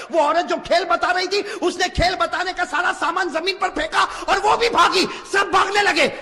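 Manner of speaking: high, loud preaching voice; full-force preaching. Acted. Preacher telling a tale of Umar to the crowd, full-force preaching. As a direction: drive the story home to the crowd.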